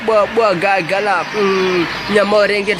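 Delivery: normal talking voice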